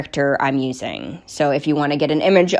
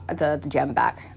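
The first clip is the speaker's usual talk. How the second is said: usual speaking voice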